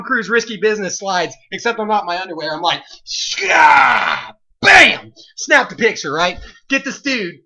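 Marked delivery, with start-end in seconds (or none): 3.0-4.3 sharp mock "shah" cry for the slide; hammed-up action-movie yell; sound effect yell. Storyteller's dramatic "shah" as he slides. Mimics his camera move sound. 4.6-5.1 high, loud "Bam!". Retold or imitated. Storyteller shouting the camera snap, full burst.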